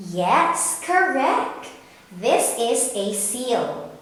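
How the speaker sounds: normal talking voice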